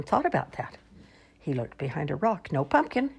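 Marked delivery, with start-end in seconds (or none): none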